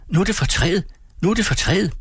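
put-on high, eager children's voices. A narrator's reading. Children begging for gifts from the tree, high and eager. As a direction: squeal it like impatient kids.

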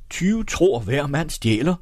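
high, provocative retort; challenging shout. A narrator's reading. The evangelist taunts Egholm back, high challenging shout. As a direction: call out the jibe as an acted challenge. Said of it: "råbte evangelisten og lå udfordrende".

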